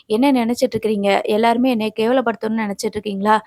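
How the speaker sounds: ordinary delivery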